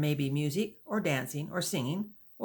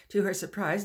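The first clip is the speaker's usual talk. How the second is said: usual speaking voice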